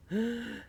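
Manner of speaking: high, soft intake of breath; awed gasp. Pretend. Unboxer showing viewers the booklet first, awed gasp. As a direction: gasp softly at the reveal.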